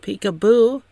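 high, loud singsong peekaboo; playful peekaboo. Pretend. Leia peeks from under the coat, playful peekaboo. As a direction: call it out high like playing with a baby.

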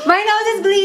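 said high through laughter; laughing. Real, not acted. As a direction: laugh through the words at your own joke. Joking about struggling with English.

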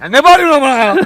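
loud, indignant comeback; loud protest. Real, not acted. Vlogger objects to being yelled at, loud protest. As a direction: push back loudly at the shouter.

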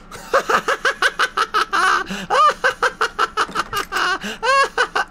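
a high, far-off burst of laughter. Real, not acted.

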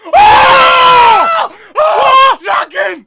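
very high, very loud shouting; excited shout. Real, not acted. Watching the dragon reveal, screaming in real excitement.

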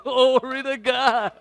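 slow, high, loud burst of praise; joyful praise. Retold or imitated. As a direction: shout it out in delight, drawn out. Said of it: "I'm like".

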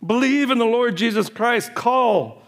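high, impassioned pulpit cry held throughout; fervent preaching. Acted. Pastor urging the church to call on the Lord, fervent high preaching. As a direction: plead with the whole congregation at full force.

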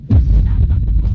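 loud, strained, half-voiced complaint; anxious outburst. Real, not acted. Gamer hiding from Slender Man, loud frightened complaint. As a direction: blurt it out despite the fear.

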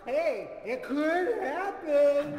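laughing puppet. Pretend. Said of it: "Mister Beer".

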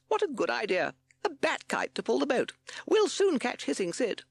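high, loud captain voice; Captain Beaky. A narrator's reading. Captain seizing on Owl's plan, high and eager. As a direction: exclaim it eagerly at high pitch. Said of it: "said Captain Beaky".

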